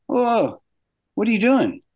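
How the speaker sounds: high-pitched startled protest; retold alarm, voice jumping up high; alarmed disbelief. Animator catching someone erasing his drawings, alarmed.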